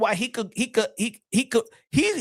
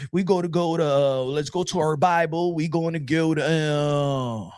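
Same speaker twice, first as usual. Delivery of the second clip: slow, even mock sermon delivery; imitating a slow, low-tone preacher; mellow-tone preacher; 3 semitones lower, slower than usual. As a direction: read scripture like a low-key, droning preacher. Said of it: "low, you know, um, tone preacher that just talk like this".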